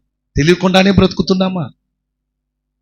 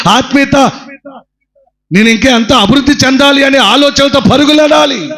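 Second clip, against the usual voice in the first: preaching at full force; 9 semitones higher than usual. Acted. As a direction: urge the flock on with fire. Preaching exhortation.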